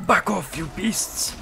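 high mock-warrior warning; Viking voice. Viking raider warns off the beasts, mock-fierce shout. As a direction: drive them off like a hammed-up raider. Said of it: "that's my Viking voice".

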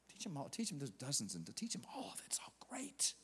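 hushed, as if sharing a secret; whisper. Acted. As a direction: breathe it to the room. Preacher drops to a whisper.

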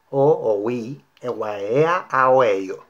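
"O, o, ui" as connected vowels, mouth shaping each one fully; vowels only, exaggerated. Shown as a demonstration. Teacher demonstrates a modulation drill, "a, a, o" in exaggerated vowels. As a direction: drop all consonants, stretch "e, ua, e" with the phrase's accents. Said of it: "decir solamente las vocales".

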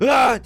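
loud, high mock battle cry; imitated charging yell. Imitating Ahmet charging in with a yell. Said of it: "diye geri ittirebileceğim".

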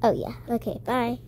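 ordinary talk

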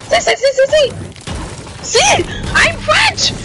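very high, insistent protest; high-pitched insistence. Real, not acted. Player insisting to teammates he is French, high-pitched.

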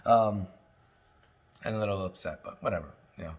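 ordinary talk, nothing marked

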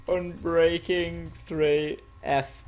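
slow, broken-up reading aloud; slow typing read-out. Real, not acted. Player mouths a message while typing it, drawn out slowly. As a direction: draw each word out slowly.

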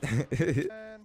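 high amused laugh; real laugh. Real, not acted. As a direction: laugh at the enemy. Laughing at opponent.

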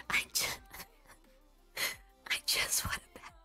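normal talking voice

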